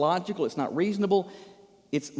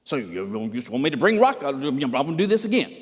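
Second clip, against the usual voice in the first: livid; 3 semitones lower than usual. Acted. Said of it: "he is livid".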